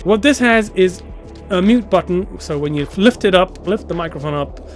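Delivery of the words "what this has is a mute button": ordinary delivery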